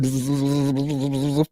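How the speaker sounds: soft low buzzing hum; bee buzzing. Retold or imitated. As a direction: buzz like bees getting closer. Imitating buzzing bees.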